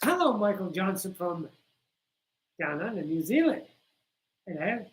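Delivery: lightly attempted, clumsy New Zealand accent; badly faked Kiwi accent. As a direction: have a half-hearted go at sounding like a New Zealander. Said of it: "I'm shitty at the accent".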